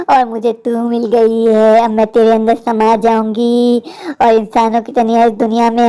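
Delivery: deep, slow ghostly voice; a vengeful spirit speaking low and slow. Acted. Ghost possessing girl speaks. (गहरी धीमी भूतनी की आवाज़)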